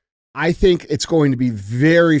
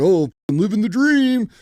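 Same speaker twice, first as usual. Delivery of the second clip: higher-pitched mock-cheerful sarcasm; sarcastic; 7 semitones higher than usual. Cynical worker answering how's it going, sarcastic. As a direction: put on a sarcastic worker's stock reply. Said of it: "Yeah, all the sarcasm".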